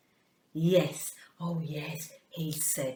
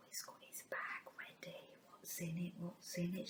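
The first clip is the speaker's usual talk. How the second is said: suspense whisper; 19 dB quieter than usual. Acted. Teacher whispering to children about the bag.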